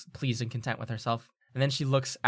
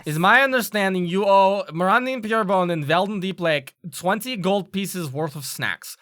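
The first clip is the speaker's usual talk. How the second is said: lightly voiced authority figure, raised and firm; Dessa giving orders; 5 semitones higher, 6 dB louder than usual. Headmistress settles a snack debt between students, firm ruling. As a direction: lay down the terms a little louder and higher.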